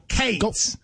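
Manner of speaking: "Cates" clipped to one syllable, "kates". Shown as a demonstration. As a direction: say "Cates" in one short beat. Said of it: "It's like one syllable".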